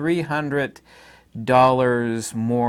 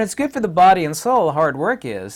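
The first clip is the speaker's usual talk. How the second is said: normal talking voice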